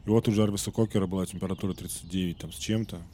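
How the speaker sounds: usual speaking voice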